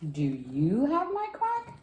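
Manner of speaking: slow, high duckling question; high-pitched, drawn-out baby-duck plea; little duckling. A narrator's reading. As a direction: stretch it out, sliding up high. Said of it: "Little Duckling asked the dog".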